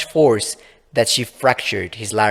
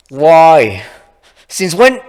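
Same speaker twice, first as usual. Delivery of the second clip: loud, rising higher in shock; stunned questions; 7 semitones higher, 10 dB louder, much slower than usual. Acted. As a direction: reel at the news. Laura stunned by news.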